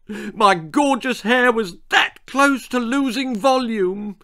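high, loud tearful tirade; overwrought, high-pitched crying complaint; blubbing. A narrator's reading. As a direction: blubber it in an indignant wail. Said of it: "she blubbed".

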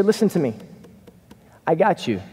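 ordinary talk

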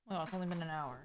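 ordinary talk

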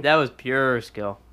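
slow, drawn-out "pyoor" for "pure"; affected "pure". Shown as a demonstration. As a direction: stretch "pure" with a clear y-glide. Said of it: "Why are you talking like this now".

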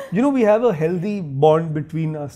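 ordinary delivery

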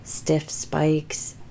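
breathy whispered complaint. Acted. Dinosaur complains about the cramped plane, whispering. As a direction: whisper it like a miserable secret.

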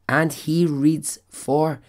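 usual speaking voice

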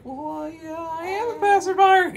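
very high, loud falsetto; high falsetto character. Acted. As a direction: squeak it out in a high cartoon voice. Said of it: "She's like".

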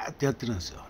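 ordinary talk

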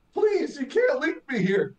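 high whiny pleading voice; imitating the trapped knight, high and pleading; mock pleading knight. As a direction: whine it like a begging coward. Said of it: "And he's like".

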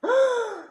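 sharp, very high gasp; shocked gasp. A narrator's reading. Animals hear the rooster's fate, loud high gasp. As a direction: gasp in cartoon horror.

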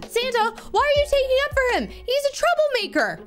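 high, fast mock indignation; indignant protest. Gamer objecting as Santa defends the troublemaker, indignant protest.

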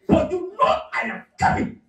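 high, whining mock complaint of the man; whiny complaint. Man complains to arriving girl.

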